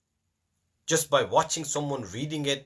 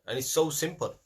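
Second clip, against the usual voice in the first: ordinary delivery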